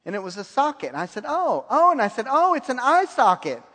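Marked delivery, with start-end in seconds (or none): 1.3-1.9 higher, louder "Oh, oh" as it clicks; excited realization. Retold or imitated. Young man praying suddenly gets the picture, excited. 2.3-3.6 higher, louder "Oh, it's an eye socket" as the punchline lands; excited discovery. Retold or imitated. Young man praying solves the picture puzzle, excited. Realizing the vision's meaning.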